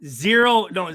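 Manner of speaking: ordinary delivery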